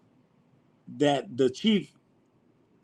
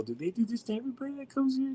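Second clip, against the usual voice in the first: amazed question; 11 semitones higher, 9 dB quieter than usual. Retold or imitated.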